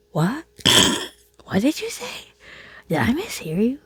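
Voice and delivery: mentally shocked, high disbelieving questions; taken aback. Retold or imitated. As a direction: squeak it out in shock. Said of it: "I was so taken back".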